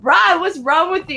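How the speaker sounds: high-pitched mock reproach; retold scolding. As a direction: imitate a baffled coworker calling him out. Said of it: "And he goes".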